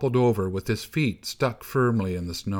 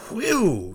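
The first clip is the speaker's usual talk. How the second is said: high, falling whoop; short gusting "whee-oo"; wind howl; 9 semitones higher than usual. A narrator's reading. Snow boy howls like wind.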